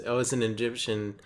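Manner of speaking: usual speaking voice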